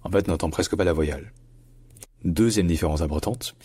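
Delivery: ordinary talk, nothing marked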